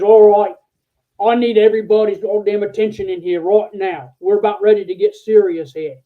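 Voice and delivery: loud, rough Australian general addressing the room, mock Australian accent; a general barking orders in a hammed-up Australian accent; bad Australian accent. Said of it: "I'm gonna do a really bad accent".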